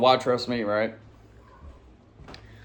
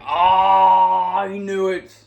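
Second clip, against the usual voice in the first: higher, exclaiming oh I knew it; a jolt of alarm at the police lights; mock alarmed realization; told-you-so dismay; 6 semitones higher, much slower than usual. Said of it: "And I'm like".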